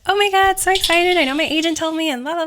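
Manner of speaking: breathless retold thrill; excited. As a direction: gush like you just got the role. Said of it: "And I'm like".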